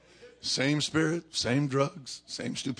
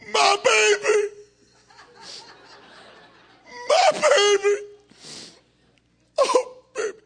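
very high, loud mock wail; hammed-up weeping; 10 dB louder than usual. Preacher acting out Edna weeping over her husband, wailing. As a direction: wail it in a squealing falsetto sob. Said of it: "is weeping".

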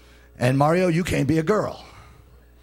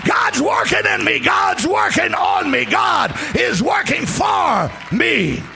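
shouted preaching; 8 semitones higher than usual. Acted. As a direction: shout the praise out, higher and higher.

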